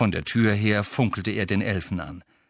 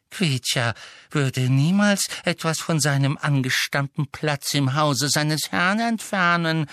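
protesting elf; 7 semitones higher, 3 dB louder than usual. A narrator's reading. Elf protesting innocence to his master, servile. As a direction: deny it in a whining creature voice.

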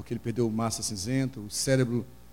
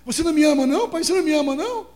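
very high, loud sobbing plea; child's crying shout; 11 dB louder than usual. Retold or imitated. Hurt son shouting at his father, loud high crying plea. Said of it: "ele falou assim".

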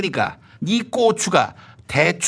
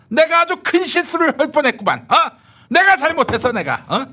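sarcastic rising rant; 9 semitones higher than usual. Acted. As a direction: scoff at his own mistake with rising heat. Escalating sarcastic outburst.

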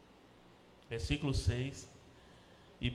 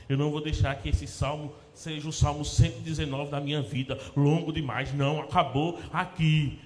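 full-force preaching; 2 semitones higher, 9 dB louder than usual. Acted. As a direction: preach it out to the congregation. (pregação inflamada, alta e forte)

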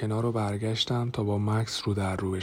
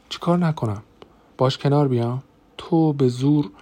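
higher, louder accusing voice; heated confrontation; 5 semitones higher, 6 dB louder than usual. A narrator's reading. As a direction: throw it back as an angry challenge. Angry confrontation with brother-in-law. (با عصبانیت و صدای بلند، معترضانه)

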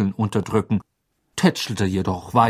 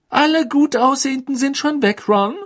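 snippy; 14 semitones higher, 5 dB louder than usual. Acted. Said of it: "sagte Hermine schnippisch".

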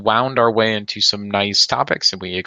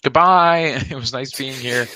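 cheery goodbye; 4 semitones higher than usual. Pretend. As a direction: belt out a bright farewell. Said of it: "would you like to say goodbye".

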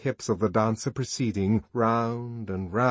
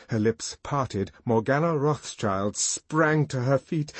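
usual speaking voice

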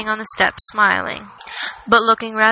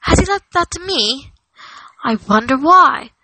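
high-pitched, puzzled hobbit voice; astonished question, raised in pitch; surprised wondering; 5 semitones higher, 4 dB louder than usual. A narrator's reading. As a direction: ask it in wonder, pitch lifted. Frodo astonished at the bequest.

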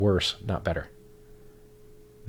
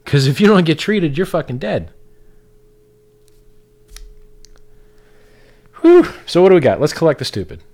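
heated; 7 semitones higher, 8 dB louder than usual. Real, not acted. Heated rant peak.

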